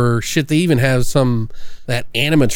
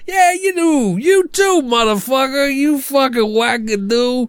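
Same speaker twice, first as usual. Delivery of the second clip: high, slow, put-on New York tough-guy voice; mock New York accent; 10 semitones higher than usual. Mocking the filmmakers, in a put-on New York accent. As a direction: ham up a wiseguy accent. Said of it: "enough with the accent".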